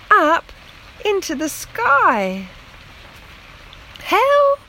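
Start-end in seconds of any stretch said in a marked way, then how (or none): 4.0-4.6 very high, loud frightened squeal; squealed help. A narrator's reading. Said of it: "squealed Lettice".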